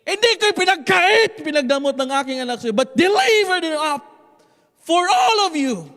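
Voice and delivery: booming, high-pitched shout, drawn out; voice of God. Acted. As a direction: proclaim it as God, slowly and at full force. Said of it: "and God said it this way". (malakas na sigaw na tinig ng Diyos)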